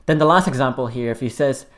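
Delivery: ordinary talk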